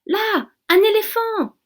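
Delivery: high, loud, thrilled cry; excited exclamation. A narrator's reading. The blackbird showing his passengers an elephant, excited. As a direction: point it out with delight. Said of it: "s'exclame Zigomar".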